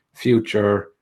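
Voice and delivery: normal talking voice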